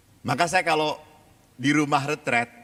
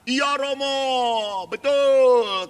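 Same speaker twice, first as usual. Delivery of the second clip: high, slow, drawn-out imitation of children; children's chorus reply; 9 semitones higher, 5 dB louder, much slower than usual. Priest imitates children agreeing they were wrong, sing-song chorus. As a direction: chant it back like an obedient class.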